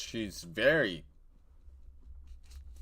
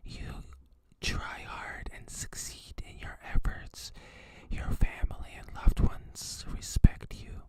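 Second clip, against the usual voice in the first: right in your ear. Pretend. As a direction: whisper encouragement close to the mic. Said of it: "I could just whisper in your ear".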